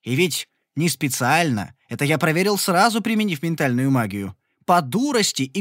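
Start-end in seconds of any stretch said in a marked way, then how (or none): none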